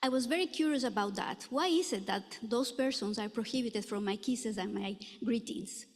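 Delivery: usual speaking voice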